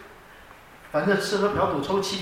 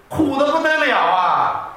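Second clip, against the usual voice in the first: slow, loud, higher complaint; exaggerated lament; 6 semitones higher, 4 dB louder, slower than usual. Real, not acted. Preacher lamenting his strict church childhood, slow loud wail. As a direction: draw out the misery.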